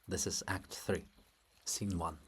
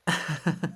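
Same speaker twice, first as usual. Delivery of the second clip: short teasing laugh, higher; a quick amused chuckle; light laugh; 6 semitones higher, 6 dB louder than usual. Acted. Libet laughing lightly at Olha's offence.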